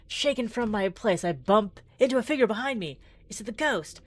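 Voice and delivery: usual speaking voice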